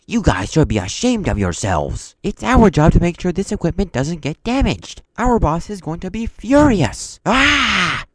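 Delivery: loud, high furious rant; losing it. Retold or imitated. Instructor scolding students over damaged equipment, loud furious rant. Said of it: "he kinda lost it".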